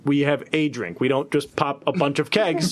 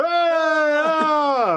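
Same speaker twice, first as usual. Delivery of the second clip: crowd gasp; 10 semitones higher than usual. Acted. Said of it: "they go".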